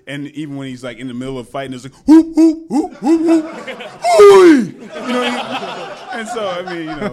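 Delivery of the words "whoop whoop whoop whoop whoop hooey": loud high whooping cries; Jim Kelly fight yell. Retold or imitated. Imitating Jim Kelly's fight yell, loud high whoops. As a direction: whoop like a fighter mid-brawl. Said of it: "Jim Kelly had that particular yell".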